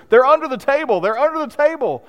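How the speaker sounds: very high, loud mock-hysterical cry; retold frantic squeal, without real laughter; imitated hysteria. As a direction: act out a hysterical outburst, pitch shooting up. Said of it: "she starts laughing hysterically and says".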